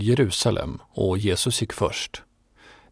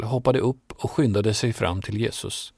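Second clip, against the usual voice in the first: ordinary delivery, nothing marked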